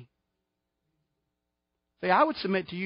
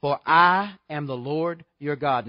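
slow, louder, weighty quoting of God's word; solemn scripture; 6 dB louder, slower than usual. Shown as a demonstration. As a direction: declare it slowly with weight on "I". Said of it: "Leviticus eleven forty-four through forty-five says this".

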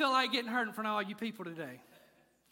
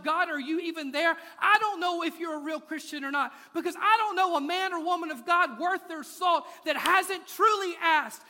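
full-force preaching; 5 semitones higher, 7 dB louder than usual. Real, not acted. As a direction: preach it hard to the crowd.

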